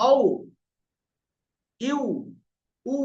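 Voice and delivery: "আউ", "ইউ", "উ" as separate rounded glides; wao after hamza. Shown as a demonstration. Teacher repeats the sakin example, rounded "আউ", "ইউ", "উ". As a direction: end "আউ", "ইউ", "উ" on open rounded lips. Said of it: "এরকম".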